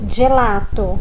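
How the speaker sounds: gelato à italiana; Italian gelato. Shown as a demonstration. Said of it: "nós lemos".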